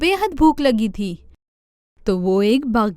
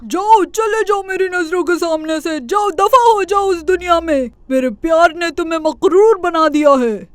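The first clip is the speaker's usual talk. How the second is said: loud, higher, furious; furious banishment; 6 semitones higher, 4 dB louder than usual. Acted. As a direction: cast the rabbit out in rage. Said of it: "غصے سے پارا چڑھ گیا".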